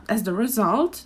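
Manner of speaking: ordinary delivery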